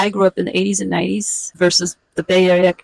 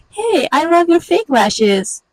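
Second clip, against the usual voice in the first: much higher, slow, sing-song fake praise; fake sweet compliment; 9 semitones higher, slower than usual. The other woman answering, high sugary fake praise. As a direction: gush it out, high and phony. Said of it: "But she says".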